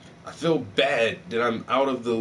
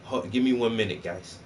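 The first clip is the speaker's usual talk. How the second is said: usual speaking voice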